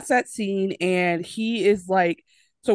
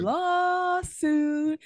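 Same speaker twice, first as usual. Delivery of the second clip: mock warning; 11 semitones higher, 3 dB quieter than usual. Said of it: "I said".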